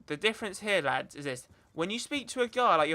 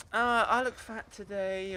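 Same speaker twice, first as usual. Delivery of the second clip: mock self-pity; slower than usual. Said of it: "They'll be like".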